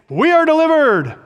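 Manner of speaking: high, loud cry of false triumph; mock proclamation. Preacher mimics sinners boasting in the temple, mock proclamation.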